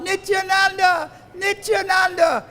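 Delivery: tearful devotee calling out Nityananda, high and long. Retold or imitated. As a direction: call the name across a distance, stretching it. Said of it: "he was calling out".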